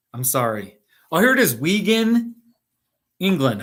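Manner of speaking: usual speaking voice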